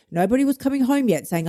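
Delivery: usual speaking voice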